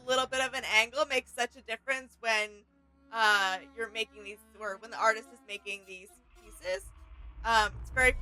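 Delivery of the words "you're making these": usual speaking voice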